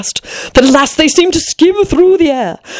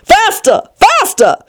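high-pitched urgent queen; crying out; 10 semitones higher, slower than usual. A narrator's reading. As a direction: urge her on insistently, high. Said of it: "the Queen still cried".

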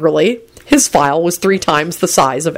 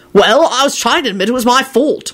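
higher, irate self-defence; 6 semitones higher than usual. A narrator's reading. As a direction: snap back in your own defence. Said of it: "Lupin responded irately".

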